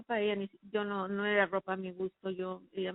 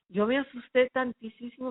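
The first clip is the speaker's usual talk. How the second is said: normal talking voice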